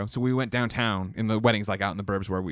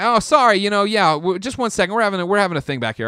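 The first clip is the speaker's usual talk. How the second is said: higher, louder hurried apology; flustered nurse; 8 semitones higher, 7 dB louder than usual. Retold or imitated. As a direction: nurse Violet rushing an apology.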